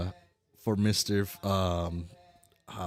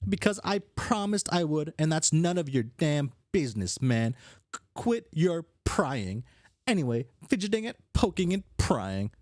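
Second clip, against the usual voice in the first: higher, testy troll voice; annoyed brash character; 8 semitones higher than usual. Acted. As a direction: tell them off for being nosy.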